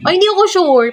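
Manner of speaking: high startled cry of doubt; surprised. Real, not acted. Streamer reacting to a chat comment, startled and unsure. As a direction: jolt up high and loud, unsure.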